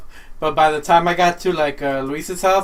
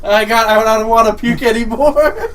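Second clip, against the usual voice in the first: laughing groan; 5 semitones higher, 6 dB louder than usual. Retold or imitated. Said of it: "and I'm like".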